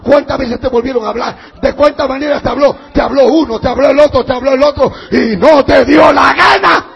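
building to a loud shout; acted divine rebuke at full force; mounting reproach. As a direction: pile up accusations until you are thundering.

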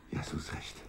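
soft whispered reassurance. Acted. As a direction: breathe it, barely voiced, to calm her. Settling the sick woman.

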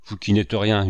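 plain reading voice

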